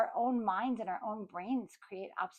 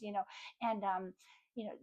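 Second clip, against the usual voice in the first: ordinary delivery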